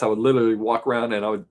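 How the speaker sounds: ordinary talk, nothing marked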